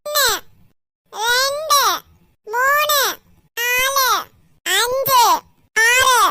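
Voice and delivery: loud sing-song counting out numbers; shouted count. Acted. Child seeker shouting the count for hiding friends. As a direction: call each number out loudly across the yard.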